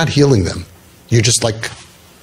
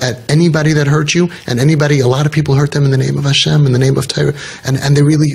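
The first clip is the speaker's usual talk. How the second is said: usual speaking voice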